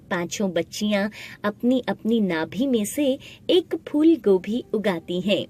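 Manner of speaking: plain reading voice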